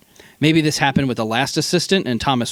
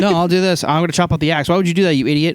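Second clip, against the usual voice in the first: mock quarrel; 6 semitones higher, faster than usual. Acted quarrel between two keepers.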